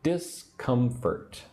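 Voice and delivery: Discomfort very slow, three separated syllables. Shown as a demonstration. Teacher showing three syllables, Discomfort slowly broken up. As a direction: stretch Discomfort out, a gap after each syllable. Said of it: "Three syllables".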